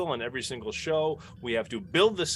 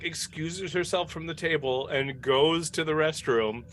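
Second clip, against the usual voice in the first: usual speaking voice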